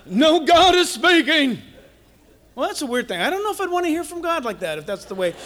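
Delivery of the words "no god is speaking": loud, slow, high preacher parody; booming fake voice of God; mock televangelist. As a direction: proclaim it with hammed-up divine importance. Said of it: "God doesn't talk like that".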